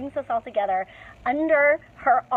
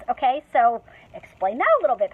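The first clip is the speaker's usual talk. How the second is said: ordinary talk, nothing marked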